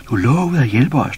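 loud, high reproachful shout. A narrator's reading. Baboon shouts at Pus, tugging his sleeve. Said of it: "råbte".

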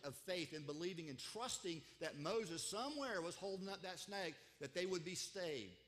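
loud, driving preacher's delivery; impassioned preaching. Real, not acted. Preacher telling how they looked in faith, impassioned.

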